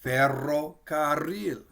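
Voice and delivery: "ferrocarril" said slowly with trilled rr; trilled R demo. Shown as a demonstration. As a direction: stretch out "ferrocarril", rolling every R. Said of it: "We'll pronounce the R a couple times".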